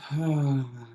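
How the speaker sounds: long, soft exhale sigh; demonstrated sigh. Shown as a demonstration. Host wiggling out tension with the group, demonstrated sigh. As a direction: release the breath slowly and audibly. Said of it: "let out a sigh".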